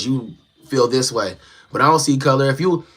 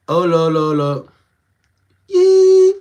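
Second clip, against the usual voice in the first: a sung lo lo lo that jumps to a much higher, louder yee; louder, much higher sung yelp; high yelp demo; 3 semitones higher, 5 dB louder, much slower than usual. Shown as a demonstration. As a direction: show off the light with a sudden high yelp. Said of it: "And it follows my voice".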